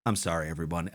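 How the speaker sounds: low, soft, deflated apology; deep voice. Retold or imitated. Clown suddenly apologizing to the audience, deep voice. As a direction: drop low and quiet as if out of character. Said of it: "deep voice".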